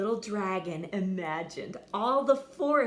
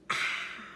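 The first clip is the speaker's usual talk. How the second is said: gasp. Acted. Dragon tries to breathe fire.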